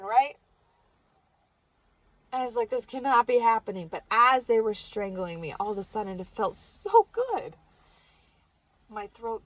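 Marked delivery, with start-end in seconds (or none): 2.7-3.9 disbelief. Retold or imitated. Reliving the choking, in disbelief.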